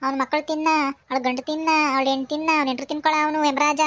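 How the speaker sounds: ordinary talk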